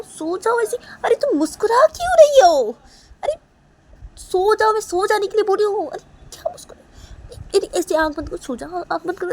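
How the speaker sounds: high, loud exasperation building to a shout; frustrated yelling that peaks at the end; angry scolding. Acted. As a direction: order the baby to sleep, losing patience. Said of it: "गुस्सा हो जाती है अपनी बेटी के ऊपर".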